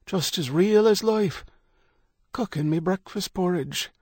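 put-on high, eerie old Irish voice; cracked old man. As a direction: tell it like an old seer. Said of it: "He spoke in a cracked voice".

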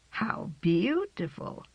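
softly admiring. A narrator's reading. Old woman admiring cat.

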